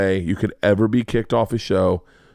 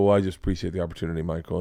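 sheepish mumble; 5 semitones lower, 6 dB quieter, much faster than usual. Retold or imitated. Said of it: "I go".

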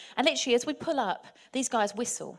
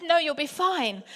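higher, drawn-out imitated Antiguan accent; 5 semitones higher, 4 dB louder, slower than usual. Boatman brushing off a tourist's worry, imitated Antiguan accent. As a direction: reassure breezily in a put-on Antiguan accent. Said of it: "I have to do an accent".

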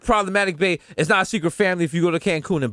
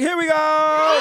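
slow, drawn-out hype shout, higher and louder; hype call; 9 semitones higher, 4 dB louder, much slower than usual. Real, not acted. Podcast host launching the episode with a slow hype shout. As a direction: stretch it out like a hype man kicking off the show.

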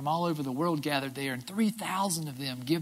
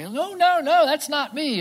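panicked denial; 10 semitones higher, 7 dB louder, slower than usual. Retold or imitated. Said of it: "denies Jesus".

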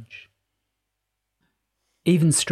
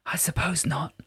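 whispering; 5 semitones higher, 6 dB quieter than usual. A narrator's reading. Jessica whispers reply.

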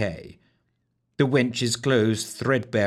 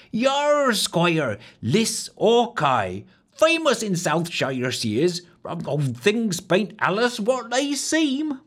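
high rustic maid, slow on the last words; country girl's grave warning; warning wench; 11 semitones higher, slower than usual. A narrator's reading. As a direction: wench cautioning the squire, much higher. The wench praises Hawkeye.

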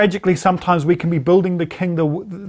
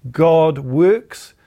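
usual speaking voice

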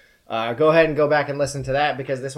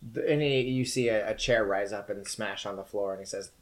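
ordinary delivery

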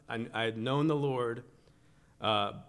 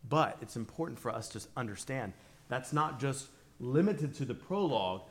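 ordinary delivery

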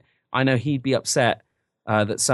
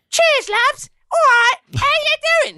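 very high, loud hammed-up Cockney chirp; mock an over-the-top Cockney heroine, high and loud; Tracer; 6 dB louder, slower than usual. Game heroine greeting the lads, exaggerated high Cockney. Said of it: "she's all like".

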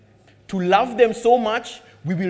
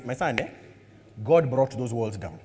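low, soft confession to son; honest fatherly admission; 7 semitones lower, 4 dB quieter than usual. Retold or imitated. Father quietly telling his son the truth. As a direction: admit it gently, low. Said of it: "only honest answer".

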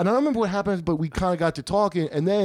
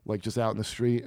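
normal talking voice

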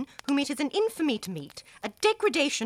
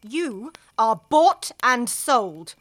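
louder, slow, scornful; slow contempt; 6 dB louder, much slower than usual. Acted. Wife scorning the woman she despises, slow and louder. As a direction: spit the verdict out slowly.

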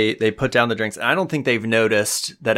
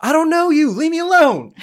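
very high-pitched panicked-sounding mock scream; acted scream; 4 dB louder than usual. Isolde screams as she smashes a glass over him, acted scream. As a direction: scream it way up high, as if fending someone off. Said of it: "what Isolde screams as she takes this".

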